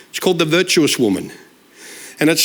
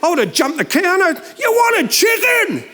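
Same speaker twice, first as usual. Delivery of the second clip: indignant yell at the mix-up; husband yelling about the chicken order, mock indignant; getting mad; 11 semitones higher than usual. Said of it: "And I'm getting mad".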